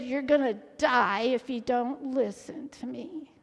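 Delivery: low, half-whispered grave warning; hushed warning. Retold or imitated. Airport staff warn passengers they will die, hushed warning. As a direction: drop to a near whisper to warn them.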